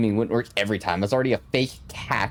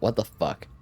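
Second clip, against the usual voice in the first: ordinary delivery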